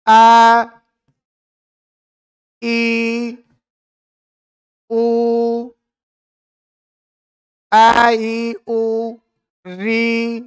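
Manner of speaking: अ, ई, उ, री each held long on a level note; prolonged pluta vowels. Shown as a demonstration. Teacher lists the three-beat vowels for pupils, each long drawn-out. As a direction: draw out every vowel from अ to लृ, with pauses between. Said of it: "प्लुत होते हैं गुरुजी".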